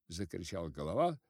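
usual speaking voice

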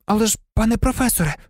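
high, quick pupil's interjection; hurried, high-pitched objection; blurted protest. A narrator's reading. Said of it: "не стрималася Герміона".

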